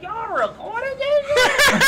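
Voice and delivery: high, slow, laughing disbelief; shocked. Retold or imitated. Comic learns her set was filmed, stunned. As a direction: stretch the shock out. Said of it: "I was like".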